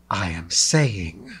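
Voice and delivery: slow, low, menacing delivery; menacing hiss. A narrator's reading. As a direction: draw it out as a low threat. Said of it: "Lilela hissed menacingly".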